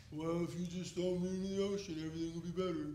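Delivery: Eeyore impression. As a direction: sigh it out like a moping Eeyore. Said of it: "like Eeyore off Winnie the Pooh".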